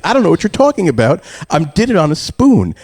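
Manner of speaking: high, loud protest of innocence; mock-indignant denial; imitating arguing son. Son denies fridge theft to parent, arguing. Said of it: "I'm arguing with him".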